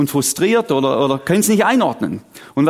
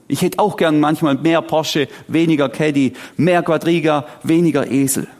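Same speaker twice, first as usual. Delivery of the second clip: ordinary talk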